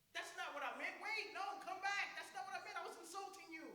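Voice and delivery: high-pitched, flustered backtracking; hammed-up exasperated objection; comic protest. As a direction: protest in mock frustration, pitch jumping up. Retold protest as insult backfires.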